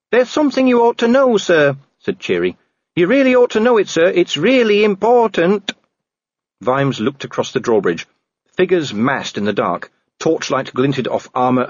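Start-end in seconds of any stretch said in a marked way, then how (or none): 0.1-5.7 high, louder urgent warning; urgent Cheery. A narrator's reading. Cheery warning Vimes, high and urgent. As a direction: press it on him, nervous.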